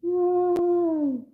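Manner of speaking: high loud yay; cheer. Real, not acted. Vlogger cheering when the stream works, excited yell. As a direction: shout it out with joy.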